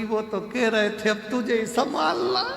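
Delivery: soft, high, rising whimper; plaintive mock sob; mock tearful. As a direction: act a wife talking through put-on tears. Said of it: "पत्नी रोती रोती बोली".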